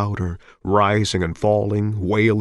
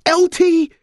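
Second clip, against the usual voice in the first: put-on high loud old mother cry; high wailing mother; old woman voice; 6 dB louder than usual. Mother cries son's name.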